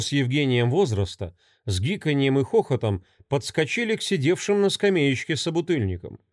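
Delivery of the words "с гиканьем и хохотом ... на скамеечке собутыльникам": ordinary delivery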